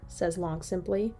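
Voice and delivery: usual speaking voice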